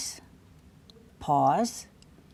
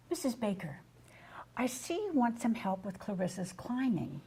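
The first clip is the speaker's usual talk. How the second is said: softer, with a smile in the voice; smiling, warm delivery; 8 dB quieter than usual. Shown as a demonstration. Provider reviews climbing tips with Missus Baker, softly and smiling. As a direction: speak gently while smiling at the parent. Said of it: "Let me demonstrate".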